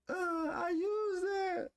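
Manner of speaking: high, slow mock sob; hammed-up crying, drawn out; mock sobbing. Mock crying over bad habits.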